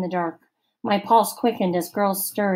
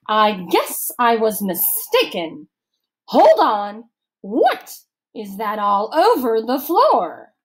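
stern camp counselor; 3 semitones higher, 5 dB louder, slower than usual. A narrator's reading. Missus Periwinkle interrogating Allison, stern, slow and loud. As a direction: lecture slowly like a suspicious matron.

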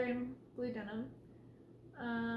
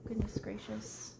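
exasperated whisper; 3 semitones lower than usual. Real, not acted. Exasperated aside after cat.

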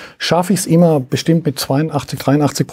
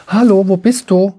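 mock child calling out; 5 semitones higher, 4 dB louder than usual. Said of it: "die schreien die ganze Zeit".

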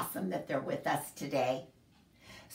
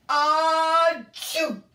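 loud high hammed-up sneeze; a huge storybook sneeze; big sneeze; 10 semitones higher, 8 dB louder than usual. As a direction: burst out a giant bear sneeze.

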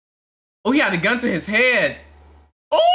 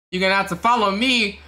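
usual speaking voice

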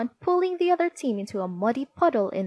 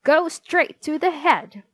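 high, loud angry dismissal; stern, shouted teacher's order; glaring teacher; 6 semitones higher, 6 dB louder than usual. A narrator's reading. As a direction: cut off the pupil's protest loudly. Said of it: "she glared".

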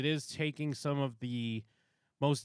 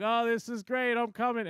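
much higher, louder, gleeful mock; happy oblivious guy; 10 semitones higher, 4 dB louder than usual. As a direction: cheer like he suspects nothing. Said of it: "he's like".

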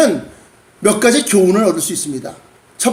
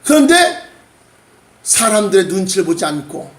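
ordinary talk

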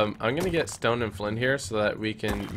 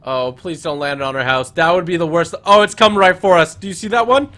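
rising, louder panic; alarmed; 5 semitones higher, 11 dB louder than usual. Real, not acted. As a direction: get louder and higher as it nears. Volcano rock flying at them.

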